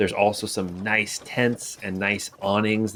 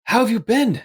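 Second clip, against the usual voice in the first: higher, eager reunion chatter; fond old friend; 9 semitones higher, faster than usual. Acted. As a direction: greet a long-lost friend gladly. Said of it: "Gerard is saying things like".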